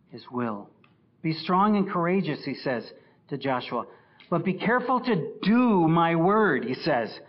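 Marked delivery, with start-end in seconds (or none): 1.2-2.4 loud, high, driving pulpit voice; forceful preaching. Acted. Preaching God's command. 4.3-6.6 loud, high, driving pulpit voice; forceful preaching. Acted. Preaching God's command.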